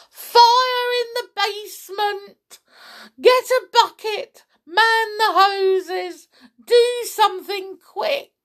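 high, loud frantic commands; old woman's yell. A narrator's reading. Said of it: "the old woman yelled".

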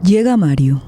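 ordinary talk, nothing marked

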